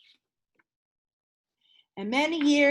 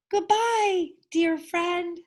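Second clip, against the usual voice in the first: high, squeaky little mouse voice; a tiny mouse squeaking goodbye to his whale friend; 8 semitones higher, slower than usual. A narrator's reading. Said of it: "squeaked Amos".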